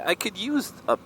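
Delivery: usual speaking voice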